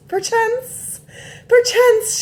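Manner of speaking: very high put-on genteel voice; a mocking high posh perchance. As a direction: pipe the word up high and prim. Mock posh word.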